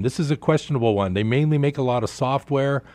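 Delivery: ordinary talk, nothing marked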